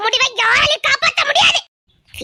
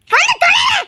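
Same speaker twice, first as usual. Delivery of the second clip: angry shout; 10 semitones higher than usual. Acted. Mother's angry outburst at husband.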